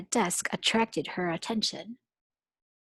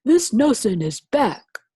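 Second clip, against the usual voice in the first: louder principal insisting; 3 semitones higher, 9 dB louder than usual. Acted. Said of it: "said Blansworth".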